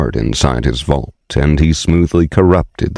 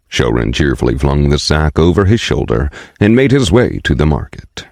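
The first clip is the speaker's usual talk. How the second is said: usual speaking voice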